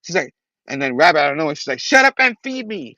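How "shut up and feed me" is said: high-pitched vengeful demand. Acted. Vampire rabbit to Spider-Man, high vengeful demand. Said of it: "she's, like, vengeful right there".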